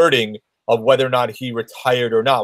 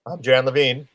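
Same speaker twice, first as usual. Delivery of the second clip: monotone. Pretend. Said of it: "with no emotion".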